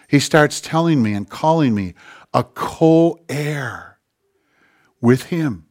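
normal talking voice

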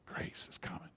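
hushed, breathless whisper of grace; God whispering comfort to the broken. Acted. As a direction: whisper it softly like God close at hand. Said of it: "God whispers".

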